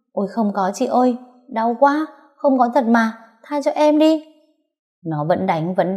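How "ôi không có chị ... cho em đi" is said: higher, pleading cry of pain; pained begging. Acted. Rival girl begging the wife while beaten, in pained pleading. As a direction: beg her to stop hitting you.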